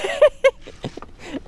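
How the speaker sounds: big high burst of laughter; real laugh at a buddy's joke. Real, not acted. Friends laughing at fishing joke.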